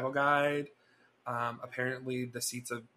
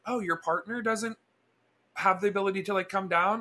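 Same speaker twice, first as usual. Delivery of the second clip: higher-pitched imitation of a neighbor; lightly voiced older lady, pitched up; 7 semitones higher than usual. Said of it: "And she's like".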